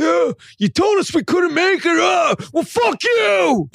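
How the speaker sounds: mock angry rant. Playing a fuming Houston fan, mock shouting. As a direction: fake a furious outburst, shouting slow. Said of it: "his joking, anticipated response from Houston fans".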